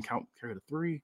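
softly muttering the count to himself; quiet confused mutter under the breath. Acted. Confused wrestler doing math, muttering it quietly. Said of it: "and muttering".

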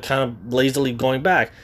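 ordinary talk, nothing marked

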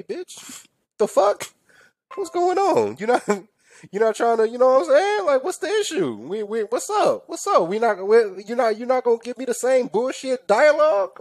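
very high, squeaky disbelief; high-pitched pretend indignation; mock-offended. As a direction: plead in comic hurt, voice way up. Mock hurt at getting no chat.

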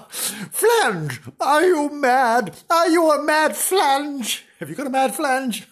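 high-pitched comic outrage; hammed-up exasperated wife; dismayed scolding. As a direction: demand it in shrieky disbelief. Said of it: "gave a shout of dismay".

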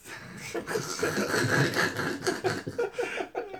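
high giggle. Real, not acted. Giggling at crude word.

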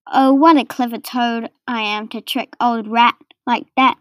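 ordinary delivery, nothing marked

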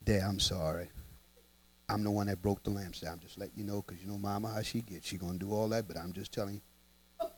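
put-on low, soft, hushed kid voice; sheepish child confession. Boy confessing to his dad about the lamp, hushed and low. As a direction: quietly own up before mama tells.

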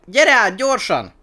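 high, very loud command; desperate shouted plea; frantic yell. A narrator's reading. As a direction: bellow for them to get across. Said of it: "üvöltött Twiga".

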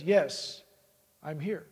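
a hint of a low heavenly voice; voice from above. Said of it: "finally this voice says".

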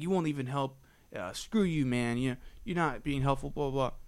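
normal talking voice

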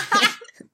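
high loud burst of laughter. Real, not acted. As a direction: break into a real laugh. Se carcajea al recordar. (carcajada aguda y fuerte)

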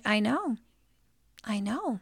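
soft, hushed comforting; gentle retold mom reply; imitating soothing mom. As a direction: quietly console a crying child. Mom soothing upset child.

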